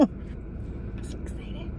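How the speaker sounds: excited little whisper. Real, not acted. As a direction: whisper it like a secret thrill. Excited aside over the food.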